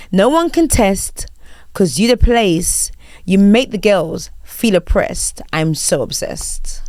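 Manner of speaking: slow, formal lyric reading. Shown as a demonstration. Host repeating the quiz lyrics, formal reading. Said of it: "such a formal H R voice".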